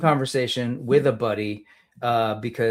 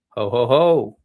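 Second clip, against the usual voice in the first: louder jolly santa laugh; a hearty mall santa ho ho ho; 8 dB louder, slower than usual. Pretend. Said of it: "No, sorry, I'll stop".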